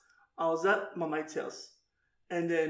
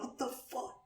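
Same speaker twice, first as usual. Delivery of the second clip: whispered aside; 6 semitones higher, 13 dB quieter than usual. Retold or imitated. Inner reaction retold.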